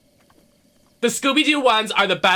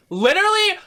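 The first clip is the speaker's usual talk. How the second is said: incredulous squeal; 14 semitones higher than usual. Acted. Incredulous fan rant.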